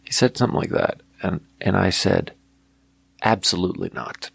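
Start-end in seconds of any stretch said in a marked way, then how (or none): none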